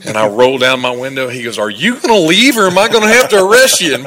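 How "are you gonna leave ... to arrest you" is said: stern cop shout. Retold or imitated. Officer barking through the window.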